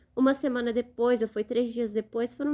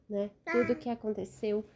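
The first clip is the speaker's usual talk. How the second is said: ordinary delivery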